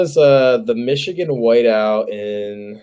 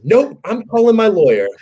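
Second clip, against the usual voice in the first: high, louder mock defiance; defiant retort; 11 semitones higher, 5 dB louder than usual. Retelling himself standing up to two cops, high defiant retort. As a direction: act out refusing the cops, pitched way up.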